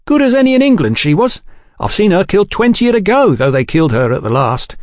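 put-on a boy boasting of the old dog; eager young waiter. A narrator's reading. Waiter boy voiced by narrator.

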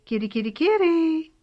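searching owner calling kitty. A narrator's reading.

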